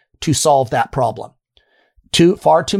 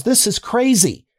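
higher, slow indignant retelling; mock outrage, pitched up and drawn out; outraged at bill; 8 semitones higher, slower than usual. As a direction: play the shocked patient staring at the bill.

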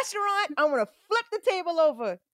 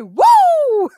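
much higher, much louder party whoop; mock stage whoop; 12 dB louder than usual. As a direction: whoop like you're hyped on stage. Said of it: "I'ma be on stage like".

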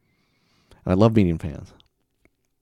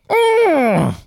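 loud, high, drawn-out squeal of frustration; comic high-pitched "ugh"; exasperated groan; 10 dB louder than usual. Acted. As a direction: let out an exaggerated frustrated wail. Acted frustration at teacher.

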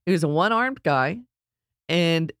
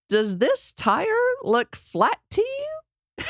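much higher, slow sing-song tease; teasing question; much slower than usual. Retold or imitated. Wife teasing husband about another flat, high sing-song. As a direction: sweetly needle him about the tire.

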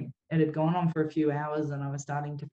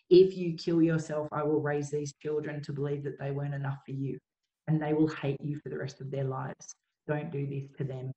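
ordinary talk, nothing marked

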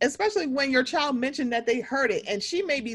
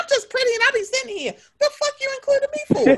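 high, fast mocking Erica voice; squeaky put-on diva, quick and snippy; 12 semitones higher, faster than usual. Retelling Erica to cohosts, high mocking voice.

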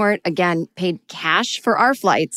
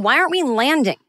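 higher-pitched panicky question; mock-panicked, pitched up; acted freak-out; 7 semitones higher than usual. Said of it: "I am freaking out. And I am saying".

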